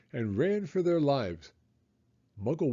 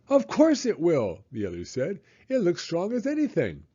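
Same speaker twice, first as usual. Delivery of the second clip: put-on confident children; 6 semitones higher than usual. A narrator's reading. Others reassure.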